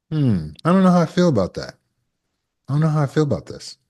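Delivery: thoughtful pause. Shown as a demonstration. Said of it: "Take a minute".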